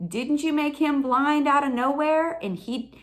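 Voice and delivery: challenging God. Retold or imitated. Believer argues with God about Saul, challenging.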